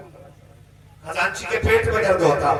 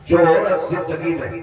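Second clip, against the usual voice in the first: ordinary delivery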